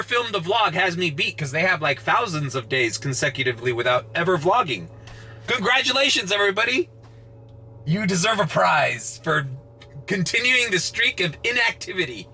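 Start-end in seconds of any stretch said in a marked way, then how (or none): 5.5-12.3 high-pitched mock praise; sarcastic congratulations. Vlogger mocking commenters' streak of inactivity, sarcastic congratulations. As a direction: hand out a fake prize with exaggerated enthusiasm.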